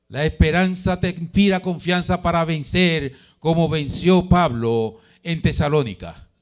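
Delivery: high, insistent sermon delivery; impassioned preaching. Real, not acted. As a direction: drive the encouragement home to the congregation. Preacher builds to a climax.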